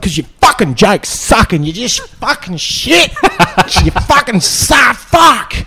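hammed-up drunk heckler yelling; imitating angry heckler. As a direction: sneer it out like a furious drunk. Heckler yelling at comedian on stage.

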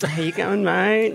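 high, drawled Aussie greeting; Aki impression. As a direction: drawl it like a laid-back Aussie surfer. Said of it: "full-on Aki impersonation".

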